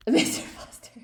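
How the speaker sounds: whispered through a giggle; laughing whisper. Real, not acted. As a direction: breathe the name with a laugh. Laughing at a student's name.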